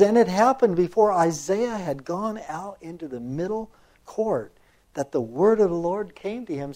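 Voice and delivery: loud, measured proclamation of a Bible verse; scripture reading. A narrator's reading. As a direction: read it out firmly, stretching the Lord's words. Reads scripture aloud.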